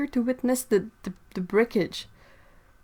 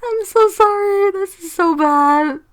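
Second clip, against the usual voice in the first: high, louder, slow sorry shaken with giggles; laughing apology; 9 semitones higher, 7 dB louder, slower than usual. Real, not acted. Streamer to chat after her slip, apologising through giggles. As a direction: try to apologise and keep losing it. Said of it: "I'm crying".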